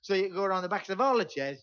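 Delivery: normal talking voice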